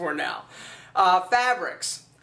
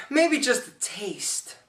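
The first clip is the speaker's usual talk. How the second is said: tempted giving-in; 3 dB quieter, slower than usual. Acted.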